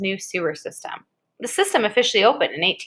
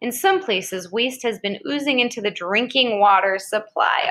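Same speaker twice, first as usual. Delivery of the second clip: ordinary talk, nothing marked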